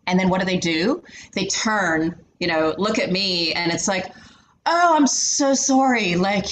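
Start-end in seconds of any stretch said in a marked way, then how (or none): 4.6-6.1 slow, higher, drawn-out fake sorry; mock apology. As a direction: gush an exaggerated, condescending apology. Mocking colleagues' overdone apology to her.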